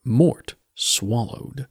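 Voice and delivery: ordinary delivery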